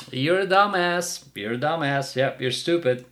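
ordinary delivery

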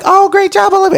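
high, bright congratulating voice; mock sweet wife.

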